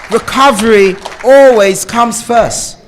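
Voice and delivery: slow and loud, each word driven home; slow, weighty conviction; emphatic declaration. Real, not acted. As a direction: plant this line like a motto. Stressing the point to the group.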